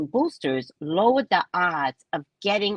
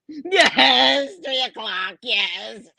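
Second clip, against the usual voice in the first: mock tearful, higher child's answer; hammed-up wailing reply; crying child; 4 semitones higher, 4 dB louder, much slower than usual. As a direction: sob out the agreement like a kid. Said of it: "you can't stop crying".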